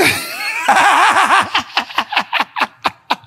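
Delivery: real high laughing fit; helpless laughter; high shrieking laugh. Real, not acted. Laughing at own [name] bit.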